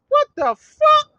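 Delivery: high, loud, slow disbelief; stunned at what they shout; shocked outrage. Real, not acted. Outraged at hecklers' death wishes.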